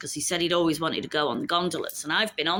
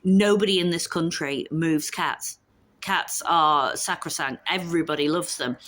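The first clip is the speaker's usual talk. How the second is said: ordinary talk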